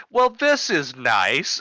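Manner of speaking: mock pleasantness. Officer lowering the kid to the ground, mock pleasant. Wry remark during a takedown.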